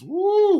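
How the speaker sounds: high, loud whoop; loud, high-pitched whoop of admiration; admiring woo. Real, not acted. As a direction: let out a long high woo. Marvelling at the voice.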